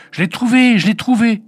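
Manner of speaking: high, loud shout of joy; jubilant, high-pitched exclamation; overjoyed cry. A narrator's reading. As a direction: cry out like a child wild with delight. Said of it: "Folle de joie, elle se mit à crier".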